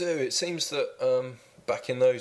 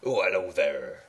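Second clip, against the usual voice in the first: a country contestant greeting the host; West Country accent; 4 semitones lower than usual. Pretend. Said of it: "I'll talk like a West Country man then".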